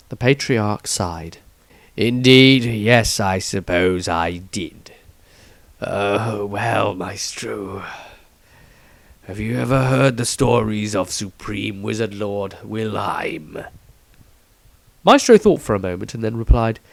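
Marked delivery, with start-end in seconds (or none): 2.0-13.6 lower, measured elder, a hint of reluctance; slow and grave, handing down a ruling. Patriarch grants Maestro permission to study, lower and slow. Patriarch voiced, sighing answer.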